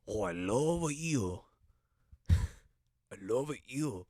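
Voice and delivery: slow, drawn-out mock Japanese accent. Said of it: "It's not rude to do an accent".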